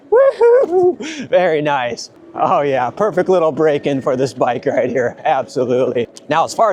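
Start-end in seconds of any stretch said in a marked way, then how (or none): 0.1-1.3 high joyful woohoo; excited whoop. Real, not acted. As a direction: whoop through the air. Whoop on tabletop jump.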